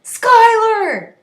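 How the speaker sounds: loud, high call into the woods; desperate yell for a lost friend; yelling a name. A narrator's reading. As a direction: shout her name as loud as you can. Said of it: "and then he yelled it".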